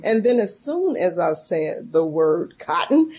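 normal talking voice

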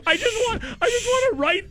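high, breaking laugh through words. Real, not acted. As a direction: talk while cracking up. Host laughing, overwhelmed.

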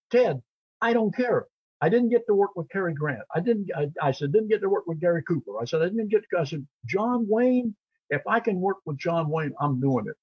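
high, eager rush of insistence; excited pleading. Retold or imitated. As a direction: plead like a thrilled young actor. Excited plea to agent.

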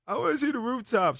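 high, squeaky imitation of a strung-out woman; mock crackhead woman. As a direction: pitch it way up like the spaced-out girl. Crackhead woman quoted again.

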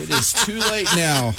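laughing, stress on is. Real, not acted. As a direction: lean on is while laughing. Laughing, repeats with emphasis.

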